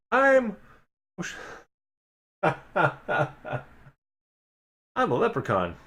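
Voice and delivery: squeaky start breaking into a laugh; lightly voiced mischievous sprite; chirpy leprechaun. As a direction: giggle through the introduction. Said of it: "chirpy little voice".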